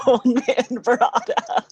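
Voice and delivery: slow repeat through laughter; laughing. Real, not acted. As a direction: draw the words out while laughing. Cracks up at the nickname.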